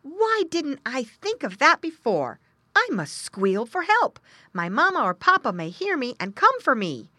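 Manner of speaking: high, loud, exclaimed little-pig voice; exclaiming little pig. A narrator's reading. Lost pig exclaims to himself, exclaiming. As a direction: exclaim it high, as a sudden idea. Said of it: "he exclaimed to himself".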